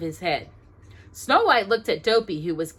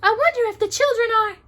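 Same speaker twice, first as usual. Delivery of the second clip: put-on high, quick girlish voice; high and quick princess musing; 7 dB louder, faster than usual. A narrator's reading. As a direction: wonder aloud as a princess.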